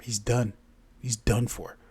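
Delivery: ordinary delivery, nothing marked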